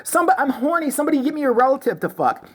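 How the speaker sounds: lightly voiced high mockery; a hint of the Westboro parody; mock fanatic voice. Mocking the Westboro woman.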